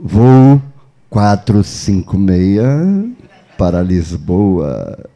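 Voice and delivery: low, slow boarding call; airport announcer. Pretend. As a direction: announce a flight over the PA, very slow. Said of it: "que nem a mulher do aeroporto".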